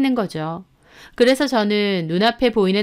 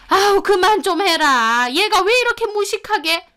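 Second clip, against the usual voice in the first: exasperated mother-in-law; 10 semitones higher than usual. Acted. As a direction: berate her while pulling her away. Mother-in-law pulls wife off husband. (질색하며 꾸짖는 시어머니 목소리)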